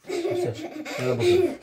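high squeal through a laugh. Real, not acted. Teasing laugh at the child.

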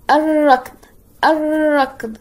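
الركض as "ar-rakd", no l, long rolled r; silent lam. Shown as a demonstration. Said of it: "نعم، لم تنطق اللام".

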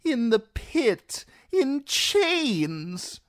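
fearful courtier wailing to the queen. Acted. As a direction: wail it like doom is coming. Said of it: "wailed Reznak Mo Reznak".

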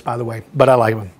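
ordinary talk

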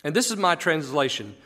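ordinary talk

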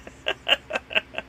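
quick breathy chuckle. Real, not acted. Vlogger amused by his own silly shirt, chuckling. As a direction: chuckle quietly to yourself.

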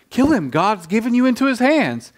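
high-pitched, insistent mock command; urgent urging. Army leader urging David to kill Saul, high and insistent.

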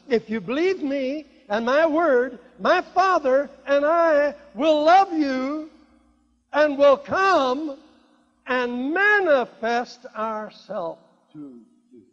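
loud, high, slow preacher's declaration; forceful scripture proclamation. Acted. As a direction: proclaim each phrase slowly at full force.

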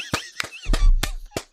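high burst of laughter; cackling at her own punchline; shrill laugh. Real, not acted.

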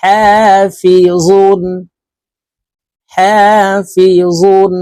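হাফিজুন drawn out slowly, vowels held; slow recitation. Shown as a demonstration. Teacher chanting হাফিজুন for the class. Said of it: "দুই পেশ জুন".

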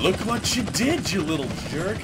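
high mocking voice of the bully; high sneering put-on voice; mocking quote. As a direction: lightly mimic the jerk who ate the pizza. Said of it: "He was all".

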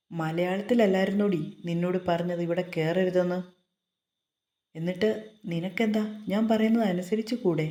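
usual speaking voice

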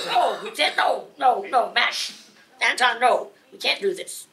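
frantic mock pleading. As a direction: cry out to stop them. Stopping men, retold.

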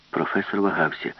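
ordinary delivery

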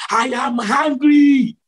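high, slow shouted declaration; angry divine voice. Retold or imitated. Preacher voices Jesus over rolling thunder, slow high retold anger. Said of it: "angrily saying that".

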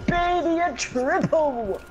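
high, slow excited meme shout; meme catchphrase. Pretend. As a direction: belt out the meme line. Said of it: "stop saying his memes".

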